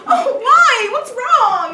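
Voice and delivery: loud, high, gushing mock concern; overdone concern. People reacting to his bad mood, mock-concerned. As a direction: fuss over him with hammed-up alarm. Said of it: "then they go".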